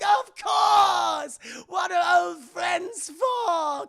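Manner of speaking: high, shouted villain cheer; Joker. Acted. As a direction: boom it out, stretching the vowels. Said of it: "He's like".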